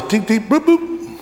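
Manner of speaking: high chirpy beeps, last one up high; high playful fob chirps; key fob beeps. Retold or imitated. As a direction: chirp like a car remote. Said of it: "takes their fob and goes".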